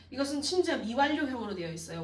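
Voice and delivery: ordinary talk, nothing marked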